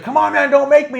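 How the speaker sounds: high, loud exasperated mimicry; imitated mad yelling. Jericho warns the ref at the count of four, imitated mad yell. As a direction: mimic a wrestler losing it at the ref. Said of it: "he was getting so mad".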